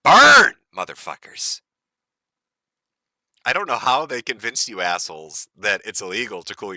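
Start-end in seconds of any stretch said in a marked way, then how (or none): 0.0-1.6 a sudden loud, high shout of "Burn"; taunting shout. Real, not acted. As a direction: yell it at them like a curse. Taunting Europe.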